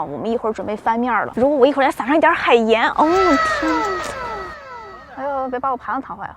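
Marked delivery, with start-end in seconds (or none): 2.9-4.0 amazed. Real, not acted. Drawn-out amazement.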